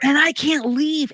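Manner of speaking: loud, high, drawn-out exclamation; exasperated. Real, not acted. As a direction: cry it out as if trapped. Exasperated at being trapped at home.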